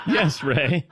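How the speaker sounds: usual speaking voice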